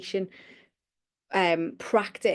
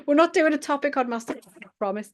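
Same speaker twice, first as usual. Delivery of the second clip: fast, higher, giggly reassurance; laughing protest; 6 semitones higher, faster than usual. Real, not acted. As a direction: insist through the giggles. Laughing over her own word slip.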